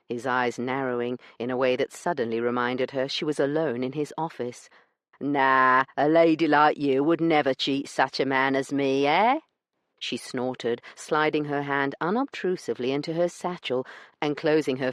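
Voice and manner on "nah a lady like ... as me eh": a smug weasel of a man brushing her off; rough dealer. Acted. Branson dismissing Io's complaint, smug and rough.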